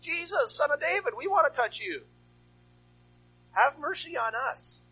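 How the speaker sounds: high pleading cry; calling out. Acted. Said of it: "they start calling out".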